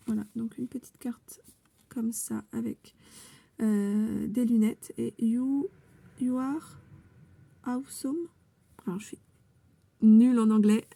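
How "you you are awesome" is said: English with a French accent; slow, halting English in a French accent. Real, not acted. Reading a card's English to viewers, French accent. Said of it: "avec mon magnifique accent de".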